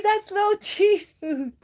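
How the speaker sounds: high, slow drawn-out reproach; slow, high-pitched chiding of a cat; scolding a pet. Real, not acted. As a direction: stretch out the telling-off in a high voice. Scolding cat stealing food.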